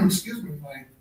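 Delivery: hoarse voice giving out; strained, hoarse throat; losing voice. Real, not acted. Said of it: "I'm losing my voice a little bit".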